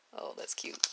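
ordinary talk

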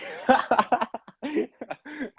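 loud, high laugh; real amused laugh; burst of laughter. Real, not acted. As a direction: crack up at the joke. Joke about fewer syllables.

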